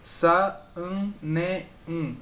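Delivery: "Sa, um, ne, um" as four detached beats; each "um" sounded on its own; separated syllables. Shown as a demonstration. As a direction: break after each syllable of "Sa, um, ne, um". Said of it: "as sílabas são separadas".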